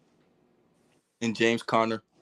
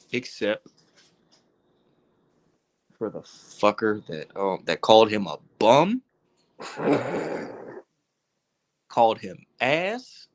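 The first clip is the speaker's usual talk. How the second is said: normal talking voice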